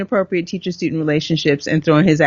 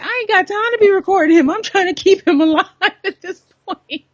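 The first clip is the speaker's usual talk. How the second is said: laughing exasperation; 11 semitones higher than usual. Retold or imitated. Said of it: "I'm like".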